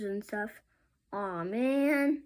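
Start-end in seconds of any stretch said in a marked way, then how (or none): none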